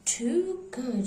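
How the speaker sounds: a mild weary sigh through the words; lightly voiced sighing cat. A narrator's reading. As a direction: sigh it out, resigned. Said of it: "sighed the cat".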